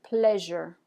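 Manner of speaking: pleasure with a buzzing zh in the middle; pleasure, voiced zh sound clearly; model word pleasure. Shown as a demonstration. Teacher models pleasure for students to repeat. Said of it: "go ahead and say that word".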